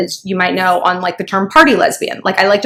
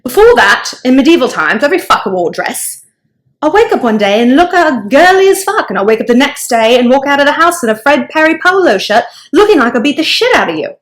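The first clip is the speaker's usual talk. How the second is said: put-on Aussie accent; 4 semitones higher than usual. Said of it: "I'm getting a little Aussie".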